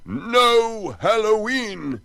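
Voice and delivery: high, loud, slow roar; furious man banning the holiday; thundered. Acted. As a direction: thunder it over the crowd. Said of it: "Butterbean thundered".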